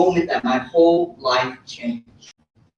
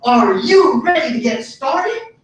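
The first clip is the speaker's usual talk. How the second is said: higher, expectant imitation of a mom; a hint of the pitching mom, drawn-out question; lightly voiced friend's mom; 6 semitones higher, slower than usual. Friend's mom asking him to join, lightly imitated and higher. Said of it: "my friend's mom comes up to me and says".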